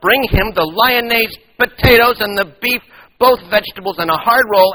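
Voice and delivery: loud overbearing mother; bossy mother. Acted. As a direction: order the waitress around over the boy. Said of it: "the mother went on".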